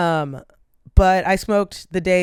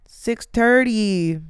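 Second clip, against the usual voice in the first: slow drawn-out Six thirty; slow tired repeat; 4 semitones higher, 3 dB louder, much slower than usual. Real, not acted. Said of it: "I'm tired".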